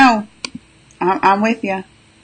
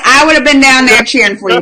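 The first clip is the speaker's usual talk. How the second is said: ordinary talk, nothing marked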